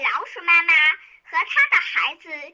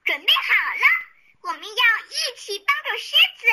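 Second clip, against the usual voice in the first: mildly serious mother mouse; 6 semitones higher than usual. A narrator's reading. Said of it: "她严肃地对孩子们说".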